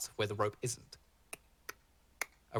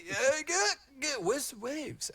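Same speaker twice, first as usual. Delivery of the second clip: bouncing with eagerness for the waves; eager surfer; slower than usual. Acted. A Triton surfer asking where the waves are, hyped. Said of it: "what is going".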